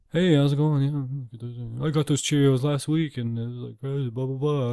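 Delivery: lightly voiced rambling old man at the store; a hint of a talkative old guy; chatty old man.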